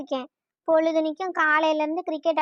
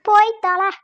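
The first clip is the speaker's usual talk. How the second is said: louder, higher burst of irritation; angry dismissal; 4 semitones higher, 6 dB louder than usual. Acted. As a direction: send him off crossly and loudly. Wife snaps during bedtime quarrel.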